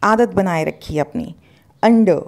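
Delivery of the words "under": "under" as UN-duh, loud and clear; silent R. Shown as a demonstration. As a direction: model "under" without the final R. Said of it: "आर को यहाँ पे आपको silent". (under में आखिरी र silent)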